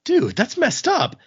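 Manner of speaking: high, slow mock disgust; disturbed onlooker. Bar crowd reacting to the arm cutting, high slow mock disgust. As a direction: pitch it way up, appalled. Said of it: "And they're like".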